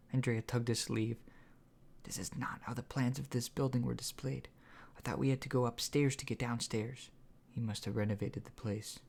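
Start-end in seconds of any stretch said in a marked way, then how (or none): 2.0-7.1 whisper. A narrator's reading. Sneaking, hushed group talk.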